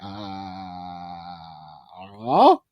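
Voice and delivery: slow, low, baffled mumble; confused Mario. Retold or imitated. Mario baffled after being rescued, slow confused mumble. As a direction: drag it out, totally lost. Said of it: "completely confused".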